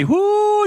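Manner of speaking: a high, excited woo; excited whoop. Real, not acted. Host whooping to hype the big news, excited.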